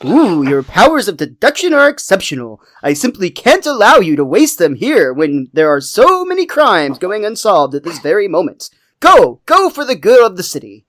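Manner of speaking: mock-impressed, sarcastic impression; Comic Book Guy. Shop owner sarcastically sending the kid away. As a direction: heap sarcastic praise on them, swooping up and down. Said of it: "I love a comic book guy".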